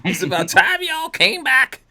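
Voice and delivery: put-on high, drawn-out Southern country accent. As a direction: exclaim it in a hammed-up Southern accent. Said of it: "I'm trying to do this in an accent".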